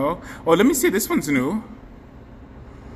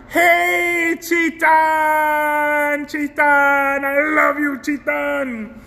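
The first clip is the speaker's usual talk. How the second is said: excited high greeting; 8 semitones higher, much slower than usual. Acted. Streamer calling out to viewer Chitan, high and excited. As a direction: call out warmly to a fan.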